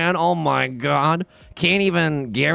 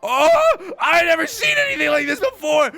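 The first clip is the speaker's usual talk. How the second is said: shrieks of disbelief, much higher and louder, with laughter; ecstatic screaming; 5 dB louder, slower than usual. Real, not acted. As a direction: scream it out like you just hit the jackpot. Huge winning hand drawn.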